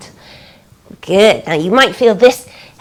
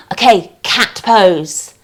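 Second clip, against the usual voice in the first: ordinary delivery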